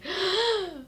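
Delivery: high startled gasp; hammed-up gasp of shock; mock gasp. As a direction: gasp like a bad movie actor. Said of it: "a really bad, like, scream".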